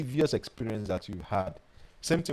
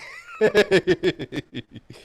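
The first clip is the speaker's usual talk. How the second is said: high, loud giggling laugh; 9 semitones higher, 5 dB louder than usual. Real, not acted. Teller amused by their own snake panic, loud laugh. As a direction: burst out laughing at yourself.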